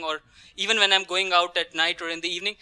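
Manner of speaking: normal talking voice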